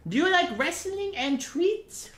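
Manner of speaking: very high, slow cooing question. Real, not acted. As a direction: coo the question to the puppy. Asking puppy again.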